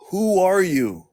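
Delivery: a loud, pushed question; retold yell. Narrator yelling at the process server, retold yell. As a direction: snap the question at him. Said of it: "And I, and I yelled".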